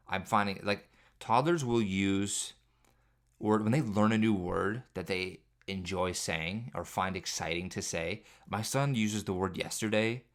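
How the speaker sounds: normal talking voice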